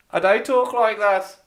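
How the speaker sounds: high, loud mock Dudley accent. Shown as a demonstration. Denying the accent while doing it, exaggerated Dudley accent. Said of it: "But that's how they talk in Dudley".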